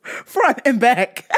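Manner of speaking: slow, wheezing laugh through the words. Real, not acted. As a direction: barely get the words out for laughing. Laughing hard at own story.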